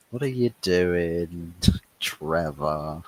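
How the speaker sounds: ordinary talk, nothing marked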